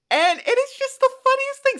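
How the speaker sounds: high, loud and delighted; excited. Real, not acted. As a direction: squeal it with delight. Excited about matching colours.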